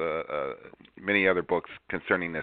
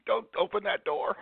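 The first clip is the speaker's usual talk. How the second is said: high, hammy dramatic alarm; mock horror; 11 semitones higher than usual. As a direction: cry out like a horror film. Host jokes mock dramatic alarm.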